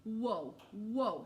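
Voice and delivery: loud, falling whoa three times; startled kid exclaiming whoa; excited whoa. Acted. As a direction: call out whoa in alarm. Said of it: "she said".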